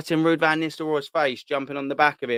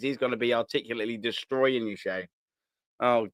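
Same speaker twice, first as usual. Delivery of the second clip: ordinary talk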